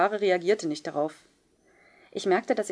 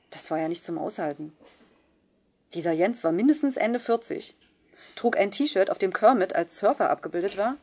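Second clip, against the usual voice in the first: ordinary delivery, nothing marked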